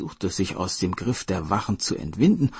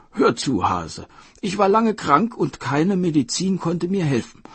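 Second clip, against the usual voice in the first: commanding king, higher and louder; Dragon King voice; 8 semitones higher, 5 dB louder than usual. Acted. Dragon King telling the hare he wants his liver, commanding. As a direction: lay out the plan with royal authority.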